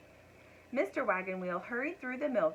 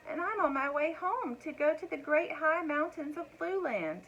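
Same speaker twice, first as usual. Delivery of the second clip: high, sweet little dragon voice; a small dragon explaining himself to the cows; baby dragon; 7 semitones higher than usual. A narrator's reading.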